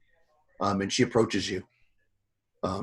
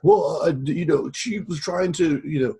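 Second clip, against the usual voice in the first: high, slow, fumbling excuse; flustered guard; 6 semitones higher, 3 dB louder, slower than usual. Acted. GM voicing the guard making excuses to Fatma. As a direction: stammer like a caught-out guard. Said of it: "the guy's like".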